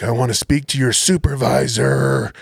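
slow, drawn-out mock yell; hammed-up indignant complaint, words stretched out; berating complainer. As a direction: imitate an irate woman demanding satisfaction. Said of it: "yelling at me".

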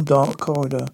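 ordinary delivery

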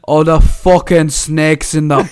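loud, slow, exaggerated Irish accent; cartoonish Irish growl of a wrestler; caricature Irish accent. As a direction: ham up an Irish accent, drawing words out. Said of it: "I like to do that accent".

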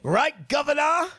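put-on chirpy British accent. Mock British bar talk.

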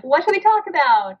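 put-on a kid jumping at the chance; eager daughter. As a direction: daughter tosses her book aside, asking eagerly. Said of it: "she's like".

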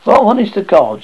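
mother crowing at her grown son, loud. Acted. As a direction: crow it out in mock despair. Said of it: "she crowed".

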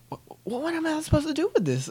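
stammering, very high-pitched protest; hammed-up squeaky exasperation; exasperated character. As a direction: sputter fast in mock disbelief. Character asks exasperatedly about check.

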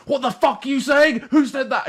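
angry Russian yell. Retold or imitated. Angry Russian teammate yelling at whoever said uwu, outraged shout. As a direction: bellow it like a furious teammate. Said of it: "angry Russian guy turns around and goes".

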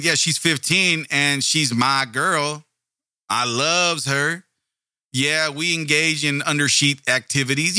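slow, drawn-out mock Southern backwoods accent; put-on Southern country drawl. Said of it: "gonna go with the Southern accent from the backwoods".

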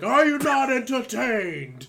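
loud, high, slow defiant roar; hammed-up arena challenge; Gladiator line. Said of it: "he's just like".

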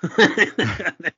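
high, loud burst of laughter; bright laugh. Real, not acted. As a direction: laugh at the coincidence. Laughing at the coincidence.

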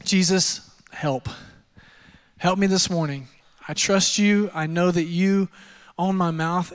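ordinary delivery

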